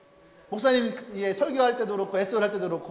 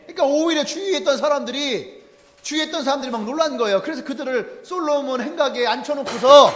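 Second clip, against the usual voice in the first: ordinary talk, nothing marked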